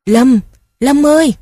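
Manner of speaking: calling out loud and high across the river; urgent call. A narrator's reading. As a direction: shout his name urgently from afar. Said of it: "nghe thấy tiếng gọi gấp gáp". (gọi gấp gáp, to và cao)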